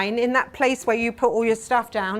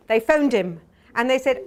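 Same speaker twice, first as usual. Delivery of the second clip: ordinary talk, nothing marked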